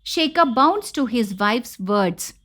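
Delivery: ordinary delivery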